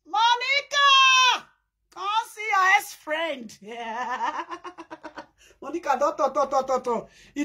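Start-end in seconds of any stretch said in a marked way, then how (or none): 0.1-3.6 high, slow, stretched-out call; mock summoning shout. Gossip calling Monica to see, mock summoning shout. 3.6-5.2 long rattling cackle; taunting laugh. Real, not acted. Gossip laughing at the ex-friend, taunting laugh.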